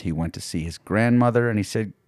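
usual speaking voice

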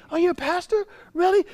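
high, loud mock disbelief; incredulous. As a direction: imitate someone floored by the news. Imitating the astonished listeners.

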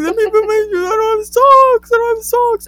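very high whiny fake bawling; mock sobbing. Kid getting dressed for the police, mock sobbing. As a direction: wail it like an inconsolable little kid. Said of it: "I literally start sobbing".

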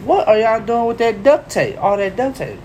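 put-on old man voice. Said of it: "Old man looks at us and say".

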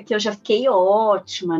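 ordinary talk, nothing marked

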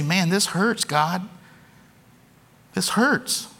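lightly acted complaint of hurting to God; mock pained complaint. Imagined complaint from the cross.

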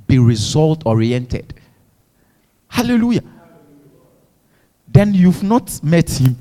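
normal talking voice

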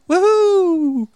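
high, drawn-out "wuhu"; mock "wuhu" whoop. Servant facing thousands of enemies, high mock whoop. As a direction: let out a high comic whoop.

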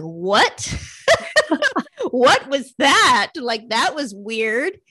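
baffled and half-laughing at the strange voice; startled laugh. Retold or imitated. Startled by the inner voice.

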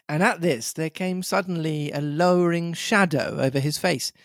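plain reading voice

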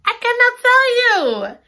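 high, loud "I cannot tell you"; squealed refusal. Real, not acted. Reader refusing to spoil the ending, squealing. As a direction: tease the viewers with a squeal.

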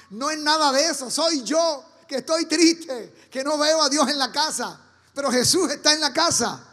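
full-force preaching. Acted. Pastor to congregation about sadness, preaching loudly. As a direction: preach it loud and insistent.

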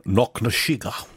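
slurred Scottish film-star impression; Sean Connery. Co-host asked to say the place name like Connery, in impression. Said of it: "you have to say it like Connery".

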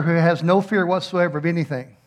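usual speaking voice

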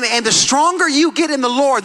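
ordinary talk, nothing marked

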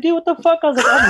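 high-pitched retold outrage; exasperated protest. Retelling his answer to the girlfriend, high exasperated protest.

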